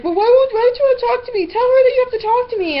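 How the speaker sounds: high, mocking nagging voice; mock whiny demand. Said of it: "he bitches at me and was like".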